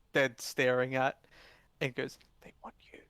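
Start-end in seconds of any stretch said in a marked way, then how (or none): 2.4-3.0 hushed, voiceless whisper. Acted. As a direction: pass it on under your breath. Young female relays message privately.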